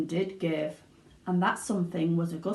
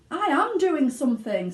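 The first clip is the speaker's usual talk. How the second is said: irritated; 8 semitones higher, 6 dB louder than usual. A narrator's reading. Husband snaps back.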